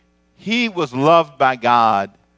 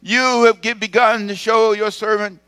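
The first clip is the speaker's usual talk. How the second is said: much higher and louder, a fervent pleading prophet; Moses pleading; 9 semitones higher, 7 dB louder, slower than usual. Acted. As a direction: cry out to God in awe. Moses' prayer acted out.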